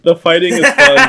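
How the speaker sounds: laughing through the words. Real, not acted. Laughing as he praises the fight scenes. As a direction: giggle out the praise.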